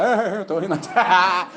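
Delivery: a lightly voiced giggly mock, slowed; imitating a grinning guy laughing for no reason; playful teasing of an audience member, mock giggly voice. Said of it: "Tava igual Fala Mansa".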